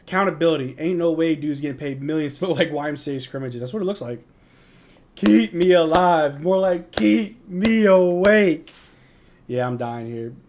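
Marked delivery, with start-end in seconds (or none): 5.2-8.7 very slow, loud, stretched through a yawn; groaning yawn-talk; yawning words. Real, not acted. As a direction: drag it out like you can barely stay awake. Sleepy viewer yawning through words.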